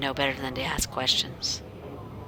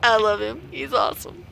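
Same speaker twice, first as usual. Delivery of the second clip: higher, excited gushing; gushing fan; 8 semitones higher, 5 dB louder than usual. Real, not acted. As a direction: rave about him. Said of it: "Sorry for my excitement".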